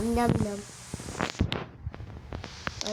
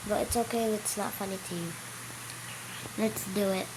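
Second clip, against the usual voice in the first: ordinary talk, nothing marked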